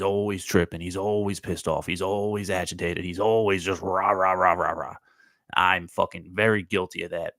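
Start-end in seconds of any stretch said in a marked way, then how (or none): 3.0-4.9 mock angry ranting. Mimicking the always-mad guy.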